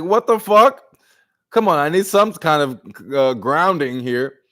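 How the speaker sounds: loud, high, fed-up "what the fuck"; exasperated outburst. Retold or imitated. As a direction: blurt it out in disbelief. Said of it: "You're like".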